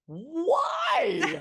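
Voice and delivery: high, loud wailing cry of dismay; mother wailing at her daughter in mock despair; anguished why. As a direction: cry it out, half laughing at yourself. Mom demands a reason.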